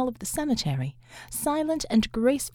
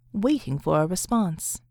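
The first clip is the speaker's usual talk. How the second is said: ordinary delivery, nothing marked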